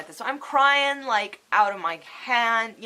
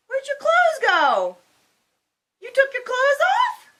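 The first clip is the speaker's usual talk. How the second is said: very high, loud cooing questions; 7 dB louder than usual. Real, not acted. Asking little one where the clothes went, playful squeal. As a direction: squeal it in drawn-out mock surprise. Playful aside to someone present.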